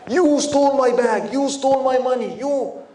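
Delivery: loud, slow, angry accusing cry; angry accusation. Acted. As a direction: hurl the accusation at him in public. Man accuses scholar of theft.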